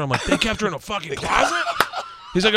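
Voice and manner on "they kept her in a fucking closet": high-pitched, disbelieving question; retold astonishment, voice jumping up; incredulous. Hearing at the museum where she was kept, incredulous.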